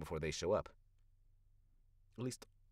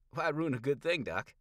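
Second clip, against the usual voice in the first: higher, louder folksy needling; 8 semitones higher, 7 dB louder than usual. Acted. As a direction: rib the doc with a grin. Critter teasing the doctor.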